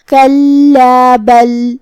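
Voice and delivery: slow, drawn-out tajweed chanting; Quran recitation. Acted. Teacher recites the verse for students, slow chant. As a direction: recite each verse slowly and melodically.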